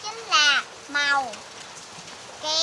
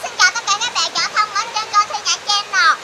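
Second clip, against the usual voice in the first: excited greeting; 6 semitones higher, 9 dB louder than usual. Real, not acted.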